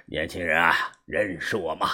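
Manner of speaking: put-on an old master greeting him with a laugh; amused old man. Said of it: "焦爷笑 了".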